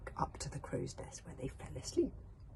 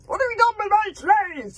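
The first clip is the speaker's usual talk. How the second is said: high furious roar, much louder; 16 dB louder than usual. Acted. Villain roars at the boy up the mast. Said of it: "he roared".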